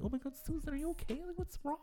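high, soft, gushing fake sympathy; mock concerned friend. Coworkers fussing over a sad colleague, mock concern. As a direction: coo it like a friend overdoing her concern. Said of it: "so they can be like".

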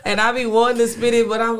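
real amused laughter in the voice; laughing. Real, not acted. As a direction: burst out laughing, then talk through it. Laughing about own unread poems.

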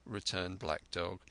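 plain reading voice

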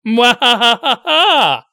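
loud evil cackle, slow; mad scientist. Pretend. Evil laugh after villain taunt.

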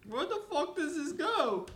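very high squeaky outcry; high-pitched exasperation. Pretend. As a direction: squeal the question in exaggerated frustration. Frustrated with puzzle piece.

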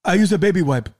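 deadpan punchline as the president; mock president. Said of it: "And he goes".

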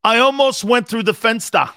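high, loud outburst; playful full-voice holler; mock shout. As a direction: bellow it out for comic effect. Shouts a German-sprinkled outburst.

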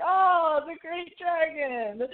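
high, drawn-out theatrical lament; mock dramatic cry. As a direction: wail it in mock awe. Retold call to the great dragon.

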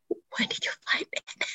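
breathy whispered question, stifling a laugh. Retold or imitated. Whispering to husband while holding in laughter. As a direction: hush it like you're trying not to crack up.

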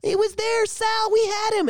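high, pleading, half-sobbing imitation; mock crying plea. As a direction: beg like a grieving kid, hammed up. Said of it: "He's like".

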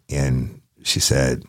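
ordinary talk, nothing marked

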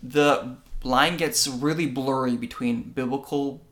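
normal talking voice

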